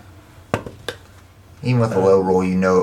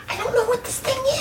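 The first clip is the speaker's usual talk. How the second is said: high nervous squeak with a laugh; high squeaky voice; 5 dB quieter than usual. Acted. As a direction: squeak it out, spooked. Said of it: "He's like".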